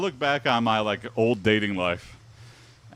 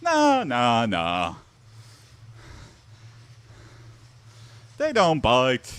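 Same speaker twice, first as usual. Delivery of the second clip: mock dog owner; much slower than usual. Voicing reassuring dog owner.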